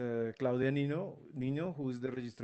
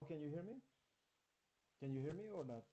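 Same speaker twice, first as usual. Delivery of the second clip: barely audible; 3 semitones higher, 12 dB quieter, much faster than usual. Real, not acted. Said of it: "but your voice is very low".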